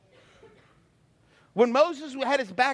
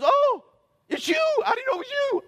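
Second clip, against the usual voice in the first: high, loud, whiny cry of surprise; startled storm; 12 semitones higher, 3 dB louder than usual. Acted. The storm, startled, recognizing Jesus with a high squeal. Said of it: "the storm goes".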